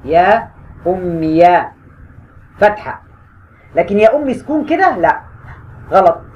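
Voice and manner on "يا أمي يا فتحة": very slow, drawn-out يا أمي; slow writing demo. Shown as a demonstration. Teacher spelling يا أمي on the board. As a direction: say it slowly while writing it. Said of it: "هكتبها كده أهو".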